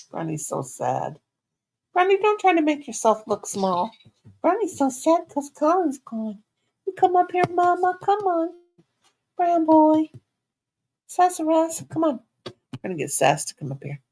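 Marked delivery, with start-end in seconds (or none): 1.9-12.2 high cooing call to the puppy; coaxing the dog. Real, not acted. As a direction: coax her up in a high sweet voice. Baby talk to dogs.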